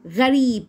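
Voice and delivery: "غريب" with a firm Arabic ghain. Shown as a demonstration. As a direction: stress the throaty gh opening "غريب". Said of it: "بيعملوا معايا صوت الغين".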